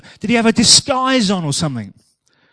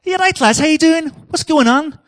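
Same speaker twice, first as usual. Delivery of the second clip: put-on high, louder regional accent; Jesus tries a different accent on the lads, higher and louder; another regional accent; 9 semitones higher, 6 dB louder than usual. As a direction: greet the lads in a much higher, brighter accent. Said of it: "Maybe he was from somewhere else".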